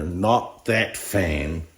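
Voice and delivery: usual speaking voice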